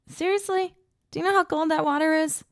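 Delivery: high, fast disbelief; incredulous. A narrator's reading. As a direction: squeal it in disbelief.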